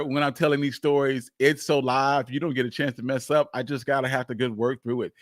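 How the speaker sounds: ordinary talk, nothing marked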